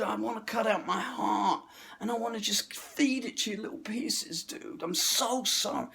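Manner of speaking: high, slow, overwrought apology fading to a whisper; Beef's apology. Retold or imitated. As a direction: grovel in over-the-top remorse. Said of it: "Beef does this thing".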